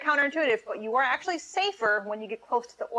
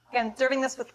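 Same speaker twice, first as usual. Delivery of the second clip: ordinary talk, nothing marked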